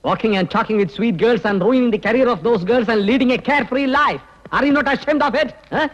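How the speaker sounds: slow, high-pitched dressing-down; rising rebuke ending on a high accusing question; indignant scolding. Acted. Officer shaming a boss over complaints about women staff, indignant.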